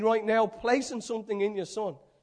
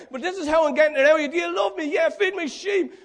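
urgent pleading; 5 semitones higher, 4 dB louder than usual. Acted. Jesus pressing Peter, acted.

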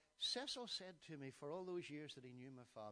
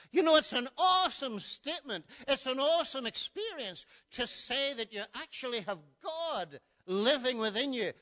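loud, higher pulpit voice; full-force preaching; 9 semitones higher, 18 dB louder than usual. Acted. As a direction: press the point on the congregation.

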